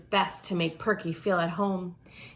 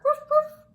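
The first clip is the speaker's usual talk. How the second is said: very high, yappy puppy-dog woof; a tiny dog barking from somewhere; little bark; 4 dB louder than usual. A narrator's reading. Perky barks from her hiding place, high yappy woof. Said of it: "they heard a little bark".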